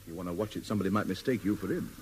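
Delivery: hushed, low aside; confidential warning. Acted. Man warning the stranger he resembles a convict, low and discreet. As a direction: drop right down as a private word of caution.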